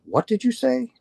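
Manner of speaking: sudden high disbelieving ask; can't believe what he just heard; startled question. Retold or imitated. To the sobbing Uber driver, high surprised asking. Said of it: "I went".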